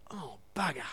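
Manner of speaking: soft, dropped-away imitated "bugger"; old patient giving up on the sentence; muttered oath. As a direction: let it slip out under the breath. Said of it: "the old B word would come out".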